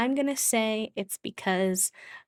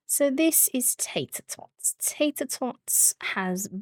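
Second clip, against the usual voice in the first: higher, slow doting voice; cooing; 5 semitones higher, slower than usual. Real, not acted. Cooing introduction to baby sim.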